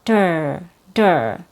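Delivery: low, neutral "der" with lips still; unstressed "der" with a quick d; weak syllable. Shown as a demonstration. Teacher showing learners the neutral mouth, weak "der". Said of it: "don't have to move your lips".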